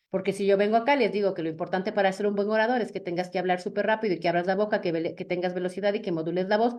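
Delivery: rushed, fast, run-on delivery; rapid-fire rambling; talking too fast. Shown as a demonstration. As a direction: race through it to show the wrong way. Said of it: "estoy hablando muy rápido".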